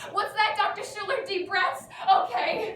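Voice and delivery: high, loud, worked-up panic; hammed-up rising panic; acted panic. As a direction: answer the therapist on the verge of hyperventilating. Acted panic at therapist, needs to hyperventilate.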